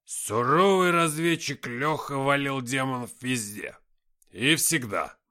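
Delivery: loud, slow, bragging tipsy voice; Никита. A narrator's reading. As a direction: proclaim it like a drunk inventing a legend. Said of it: "сильно поддатый Никита". (громкое пьяное бахвальство)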